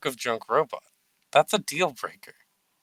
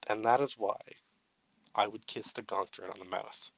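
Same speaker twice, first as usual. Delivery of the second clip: mock solemn tribute. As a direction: give a grand, mock-serious speech.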